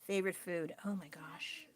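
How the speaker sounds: soft whisper, reading the prompt; whispered to herself. Real, not acted. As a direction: murmur the question under her breath.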